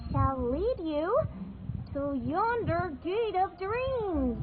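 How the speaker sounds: drawn-out grand proclamation; slow, dramatic repeat. Acted. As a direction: stretch each word for effect. Repeats line slower, grander.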